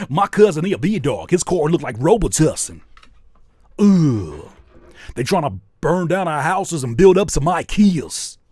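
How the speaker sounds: hyped, loud rapper voice imitating E-40; E-40 impression. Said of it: "E forty does that".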